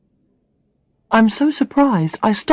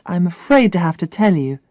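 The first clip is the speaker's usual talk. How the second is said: ordinary delivery, nothing marked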